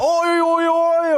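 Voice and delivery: loud, high run of British vowels; hammed-up Northern English "oi" noises; mock Northern British accent. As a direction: yelp British vowels with no consonants. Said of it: "the less consonants they use".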